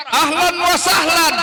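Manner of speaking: high, slow calling greeting; demonstrated Arabic welcome. Shown as a demonstration. Teacher showing how to welcome children in Arabic, high drawn-out greeting. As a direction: draw the welcome out and pitch it high.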